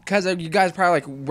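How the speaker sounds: ordinary talk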